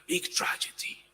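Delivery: whispered grief. Acted. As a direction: breathe the words out unvoiced. Whispered echo of tragedy.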